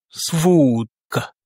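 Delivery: Suvodka very slow, with "VOOOD" stretched; stress on O. Shown as a demonstration. Teacher shows the tonic accent, stress on O. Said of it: "l'accent tonique est mis sur le O".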